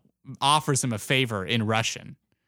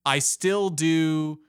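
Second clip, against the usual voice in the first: slow quote; 3 semitones higher, much slower than usual. Retold or imitated. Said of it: "He's like".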